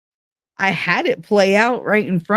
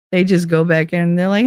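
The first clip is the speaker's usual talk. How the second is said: ordinary talk, nothing marked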